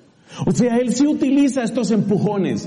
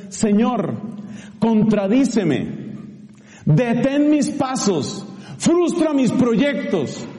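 slow, higher prayer, each plea drawn out; prayer demo; 3 semitones higher, much slower than usual. Shown as a demonstration. Preacher teaching congregation a prayer: slow, lifted pleading. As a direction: pray it slowly, pleading upward. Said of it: "te voy a enseñar la oración".

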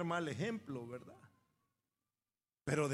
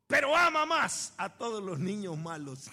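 priest's punchline; 2 semitones higher, 8 dB louder than usual. Retold or imitated.

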